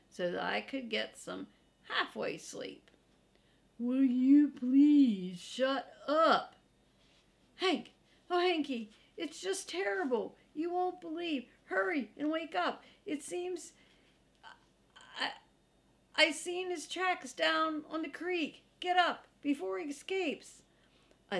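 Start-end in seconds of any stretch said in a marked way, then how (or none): 3.8-6.6 loud, slow, fed-up bark; grumpy dog woken from sleep, hollering; annoyed shout. Acted. Woken dog snaps at pest. 7.6-20.6 high, frantic little-dog voice; excited young dog babbling alarm; squealing panicky dog. Acted. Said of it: "giving off a high-pitched squeal".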